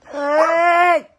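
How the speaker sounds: loud high call; shout. Real, not acted. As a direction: yell to get her attention. Calling mom, loud.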